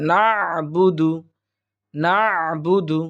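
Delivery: "না'বুদু" slow, squeezed throat sound after "na"; correct throat ayn. Shown as a demonstration. Teacher modelling the throat letter, careful tajweed. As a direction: hold the constricted ayn in "না'বুদু". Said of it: "কণ্ঠনালীর মাঝখান থেকে উচ্চারণ হবে".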